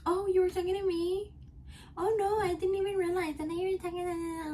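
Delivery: high mock-innocent voice of a coworker pretending she never heard. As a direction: mimic her playing dumb, sing-song and high. Mocking the coworker's fake innocence.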